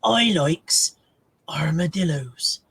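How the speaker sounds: a hint of the old TV ad voice; advert voice. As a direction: recite the old advert line slowly. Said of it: "remember the Doim advert".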